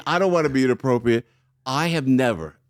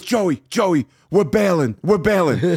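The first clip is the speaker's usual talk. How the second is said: louder, higher urgent announcement; retold urgency; 5 semitones higher, 5 dB louder, slower than usual. Star telling his friend they are leaving, urgent. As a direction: imitate a friend insisting on escape. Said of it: "he goes".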